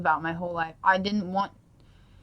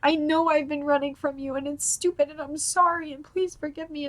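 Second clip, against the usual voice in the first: high-pitched, earnest begging; reliving a desperate plea for help; pleading prayer; 7 semitones higher than usual. Retold or imitated. Mother begging God for forgiveness, pleading. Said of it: "I was just praying".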